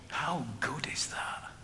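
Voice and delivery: slow breathy retold whisper of a dying man. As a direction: breathe it out, barely voiced. Dying brother's words retold.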